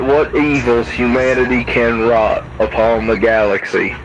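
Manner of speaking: usual speaking voice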